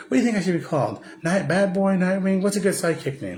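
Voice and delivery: high mock whine, ranting; pissed-off Robin. Angry Robin mocking Batman about his name, high whiny voice. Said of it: "he gets pissed".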